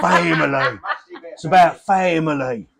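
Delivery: put-on laughing Cockney gangster accent. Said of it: "Mitchell Brothers on EastEnders say".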